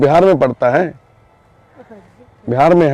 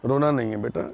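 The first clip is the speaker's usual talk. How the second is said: रोना नहीं है बेटा hushed and lower, soothing; soft comforting; 6 semitones lower, 10 dB quieter than usual. Real, not acted. Comforting a frightened girl. (धीमी, प्यार भरी तसल्ली)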